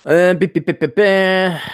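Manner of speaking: spoken beeps, loud and slow; mock beeping. Vlogger beeps to cut to an ad, slow loud beeps. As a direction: beep like a cartoon machine.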